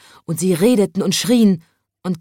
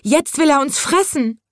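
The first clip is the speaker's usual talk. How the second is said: much higher scared wail; trembling fear; 10 semitones higher than usual. A narrator's reading. Crowd fearing the lion will eat them, high and scared. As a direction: sound sure he will eat you. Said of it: "sagten die Leute zitternd".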